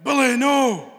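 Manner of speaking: mock-dramatic plea like the TV scene; Roland moment. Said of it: "it was like the Roland moment".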